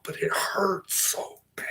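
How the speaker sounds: breathless whisper of mock pain. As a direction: whisper it like it really hurts. Pretends to have chopped pinky.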